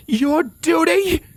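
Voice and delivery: high, drawn-out furious yell; screamed disbelief, slow and very high; outraged tirade. Acted. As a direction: throw the words back as an incredulous shout. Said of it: "When Scarlet's tirade came to an end".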